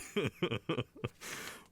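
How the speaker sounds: soft, falling scornful laughter; condescending drawn-out chuckle; quiet mocking laugh. Mocking the angry claim.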